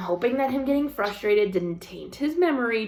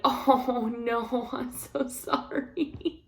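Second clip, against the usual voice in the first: high, soft apology breaking into laughter; laughing sympathy; 3 semitones higher, 5 dB quieter, much slower than usual. Retold or imitated. Wife consoling frustrated husband, laughing sympathy. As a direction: commiserate while failing to hold in a laugh.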